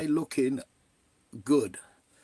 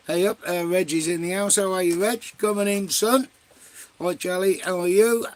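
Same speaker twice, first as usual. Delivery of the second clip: loud greeting; 4 semitones higher, 6 dB louder than usual. Real, not acted. Greeting viewers joining the stream.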